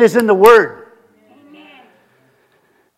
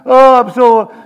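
acted guilty wail to God; mock anguished prayer; feeling bad; 4 semitones higher, slower than usual. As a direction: plead higher, as if overcome with guilt. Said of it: "and you feel bad".

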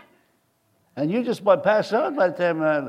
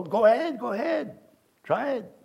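higher, softer offhand dare; light retold challenge, pitched up; mild dare; 7 semitones higher, 4 dB quieter than usual. Young worker answering a man's curse threat, lightly daring. Retelling his cocky reply to threat.

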